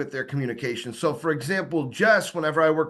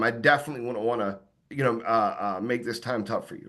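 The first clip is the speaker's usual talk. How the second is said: lower, sympathetic voice, softening toward the end; sincere condolence; 4 semitones lower than usual. Shown as a demonstration. Salesman to a homeowner who lost his wife, sincere sympathy. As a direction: gently offer heartfelt condolences. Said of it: "put my hand on my chest".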